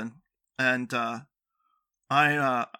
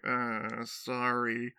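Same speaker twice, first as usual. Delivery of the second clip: softer, mild guilty murmur; sheepish apology; 2 semitones lower, 6 dB quieter, much slower than usual. Retold or imitated. Boyfriend who felt so bad both times, sheepish. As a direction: own up reluctantly, quietly. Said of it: "I felt so bad both times".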